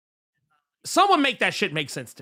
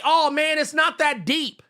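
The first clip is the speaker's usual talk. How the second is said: mocking dismissive people; 8 semitones higher than usual. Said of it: "And then people wanna say".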